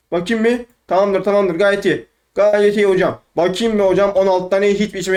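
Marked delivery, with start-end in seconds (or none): none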